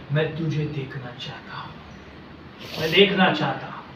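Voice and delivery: low, soft acted longing; hushed plea. Blind Bartimaeus tells the Lord his wish, hushed plea. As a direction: say the wish quietly and intimately.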